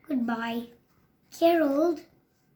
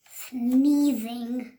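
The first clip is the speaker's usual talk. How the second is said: plain reading voice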